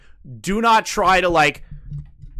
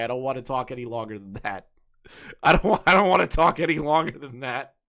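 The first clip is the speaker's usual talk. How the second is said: usual speaking voice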